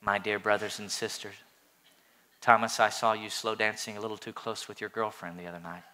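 low, soft, meek preacher voice; mock weak Jesus. Mocking the movie Jesus with a meek, soft voice. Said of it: "He talks like this".

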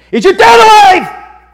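very loud, high, drawn-out bellow; rough shout. Acted. Interpreter interrogating the brothers about their father, rough shout. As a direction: bellow the question roughly, slowly. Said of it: "that's what it means to speak roughly".

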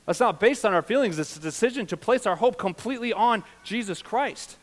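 high, loud sermon emphasis; forceful preaching. Real, not acted. Pastor urging church to place hope on Christ, forceful preaching. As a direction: drive it home to the congregation.

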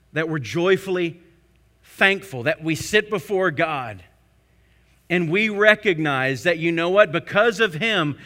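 loud, building sermon voice; full-force preaching. Acted. Pastor stirring the church to thankful joy, preaching hard. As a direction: lift them up, building.

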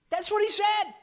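loud, high-pitched exclamation; emphatic preacher's shout. Real, not acted. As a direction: shout it out to the congregation. Preacher drives the point home.

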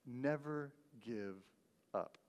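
quiet echo. Shown as a demonstration. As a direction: let it sink in, almost to yourself. Quiet repeat of the quote.

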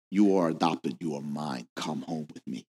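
put-on soft, low and gentle, like a father welcoming a child home; tender call. Preacher voicing God calling the orphan home, softly and gently. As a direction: hush it down to a tender invitation.

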